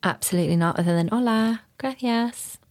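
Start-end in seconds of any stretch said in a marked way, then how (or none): 1.1-2.6 put-on Spanish words in a higher, light Spanish accent; a few touristy Spanish phrases, lilting Spanish accent; mock Spanish accent. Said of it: "Accent there, though".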